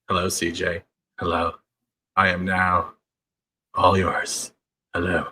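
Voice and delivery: low, soft put-on hello; hammed-up greeting. Greeting CJ in the chat, hammed-up and low. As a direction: drop low and quiet, pausing between phrases. Hams it up for CJ on camera.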